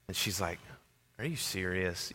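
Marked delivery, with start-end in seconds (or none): 1.2-2.1 very quiet, low disbelief; deadpan exasperation. Retold or imitated. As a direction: imitate a wife too fed up to shout. Wife hears phone is lost.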